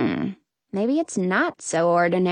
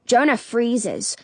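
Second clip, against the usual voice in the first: ordinary delivery, nothing marked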